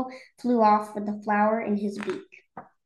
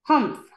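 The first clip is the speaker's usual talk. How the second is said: higher, louder dismissive grunt; scornful humph; 4 semitones higher, 5 dB louder than usual. A narrator's reading. A sour voice scoffing at Horton, scornful humph. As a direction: huff it out with contempt. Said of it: "humphed a voice".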